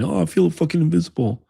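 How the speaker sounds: high-pitched complaining imitation; mock whining. Speaker mocks men who feel ignored, whiny. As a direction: mimic a self-pitying complainer. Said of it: "you whine and complain".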